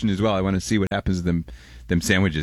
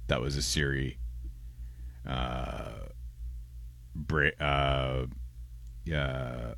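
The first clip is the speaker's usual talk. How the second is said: normal talking voice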